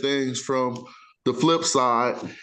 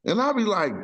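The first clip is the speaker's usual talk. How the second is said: usual speaking voice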